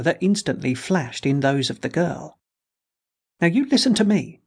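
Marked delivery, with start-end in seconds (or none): 3.4-4.4 higher, sharp angry retort. Acted. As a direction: snap back at her father. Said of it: "she returned angrily".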